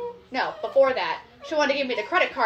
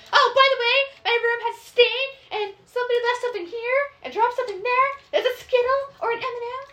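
whiny guest; 7 semitones higher than usual. Retold or imitated. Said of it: "they're just like".

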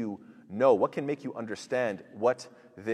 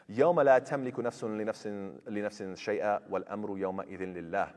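fast, chanted Quranic recitation; 3 semitones lower, much faster than usual. Acted. Teacher reciting the verse to students, chanted and fast. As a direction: recite the verse in full tajweed flow.

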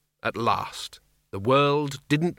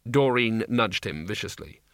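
ordinary delivery

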